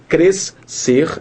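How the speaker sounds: CRESCER split in two, CRESS... SER, doubled S sound; wrong pronunciation. Shown as a demonstration. Teacher showing how crescer is not said, two separate S sounds. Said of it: "nós não falamos".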